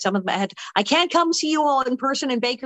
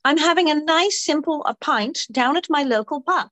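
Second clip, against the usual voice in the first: bright British pub lady; high-pitched Cockney barmaid voice; British accent; 5 semitones higher than usual. Acted. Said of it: "I've been British all week".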